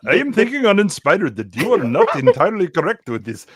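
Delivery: emphatic, bouncy put-on Indian accent; Indian tech support. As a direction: critique as a mock Indian support agent. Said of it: "a Indian tech support".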